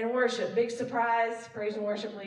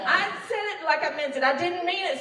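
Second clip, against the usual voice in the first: loud, higher mock-indignant kid; protesting child; 5 semitones higher, 5 dB louder than usual. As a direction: argue back like a kid defending an apology. Acted child arguing back at mother.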